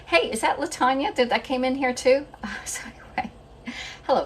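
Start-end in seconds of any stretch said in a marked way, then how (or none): 0.0-2.3 high playful mock viewer voice; a hint of an eager fan calling out; cheery viewer greeting. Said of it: "also say".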